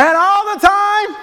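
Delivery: slow, loud shouted call And all the time; shouted prompt. Shown as a demonstration. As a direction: shout the prompt to rouse the crowd. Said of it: "say it with your chest".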